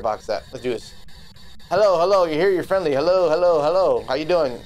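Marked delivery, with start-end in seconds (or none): 1.7-4.0 loud, raised sing-song calling into the dark. Real, not acted. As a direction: call out to an unseen ghost. Calling out to the ghost.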